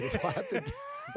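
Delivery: laughing while talking. Real, not acted. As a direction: break into a laugh mid-line. Co-host jokes about quitting.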